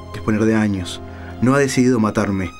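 normal talking voice

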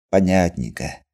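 plain reading voice

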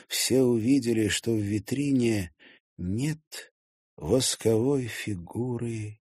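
ordinary delivery, nothing marked

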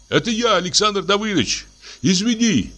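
high apologetic call. A narrator's reading. Laevsky calls back to Samoylenko through the window, high and apologetic. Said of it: "позвал он".

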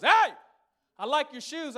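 high, loud street guy voice; hammed-up mugger hailing; mock robber call. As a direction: call out like a sketchy guy sizing up shoes. Robber calling out to him.

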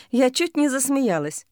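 usual speaking voice

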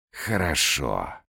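slow, languid castle voice; languid sigh. A narrator's reading. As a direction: sigh it out lazily. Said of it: "томно вздохнул тот". (томно, со вздохом)

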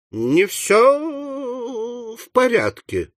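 very slow, high, trailing hint; drawn-out, wary euphemism; uneasy insinuation. Acted. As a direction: stretch it out high and delicately. Hints the boy is mad.

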